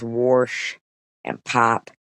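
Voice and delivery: Pittsburgh "Warsh" with an added R; lightly imitated Pittsburgh pronunciation; Pittsburgh accent. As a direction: mimic her Pittsburgh accent. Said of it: "They do that in".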